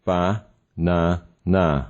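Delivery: "Ba, na, na" as three separate beats; split into syllables. Shown as a demonstration. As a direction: pause after each syllable of "Ba, na, na". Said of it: "break them into three parts".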